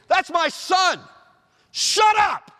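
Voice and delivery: booming high shout from the cloud; startling cloud voice. Acted. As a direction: bellow it as God cutting Peter off. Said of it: "this voice comes out of the cloud".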